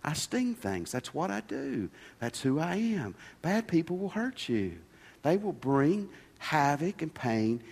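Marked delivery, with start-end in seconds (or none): 0.0-3.1 soft, matter-of-fact villain; smiling scorpion. Acted. Scorpion answering the dying frog he stung, smiling and soft. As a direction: say it quietly, with a slight smile. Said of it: "The scorpion smiled and said".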